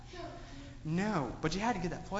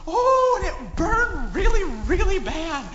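dramatic pain; 11 semitones higher, 10 dB louder, slower than usual. A narrator's reading. Burn scene climax.

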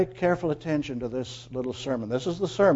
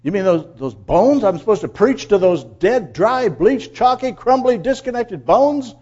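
higher and louder, building disbelief; incredulous prophet; 6 semitones higher, 8 dB louder than usual. Acted. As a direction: pile up each word in disbelief, slowing at the end. Acting out Ezekiel's doubt at command.

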